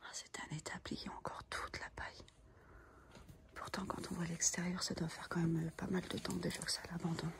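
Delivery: hushed whisper, barely voiced; library whisper. Real, not acted. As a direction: whisper so nobody hears you. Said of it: "Je parle tout bas". (chuchotement discret)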